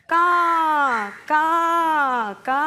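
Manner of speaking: teacher's imitation crow calls; crow caw. Shown as a demonstration. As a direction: caw three times, spaced out. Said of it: "കാക്ക എങ്ങനെയാണ് ശബ്ദം ഉണ്ടാക്കുന്നത്".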